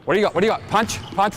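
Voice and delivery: ordinary talk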